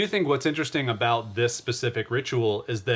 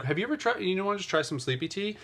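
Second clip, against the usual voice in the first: gentle coaxing; 7 semitones higher, 5 dB quieter, faster than usual. Retold or imitated. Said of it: "I was like".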